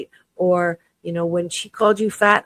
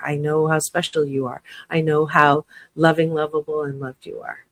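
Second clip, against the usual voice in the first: usual speaking voice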